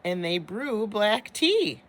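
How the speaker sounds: ordinary delivery, nothing marked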